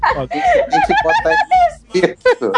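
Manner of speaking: laughing. Pretend. Laughing high voice.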